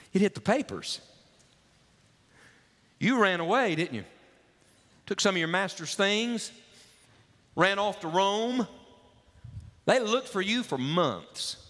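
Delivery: a curious man pressing the runaway about his past; probing stranger. Acted.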